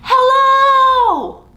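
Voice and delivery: big, high, shouted hello; joyful loud call. Shown as a demonstration. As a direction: shout it as loud as you can. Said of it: "as loud as we can".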